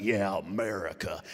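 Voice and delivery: lightly voiced Rocky. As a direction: growl it out like a proud, determined boxer. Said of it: "He's like".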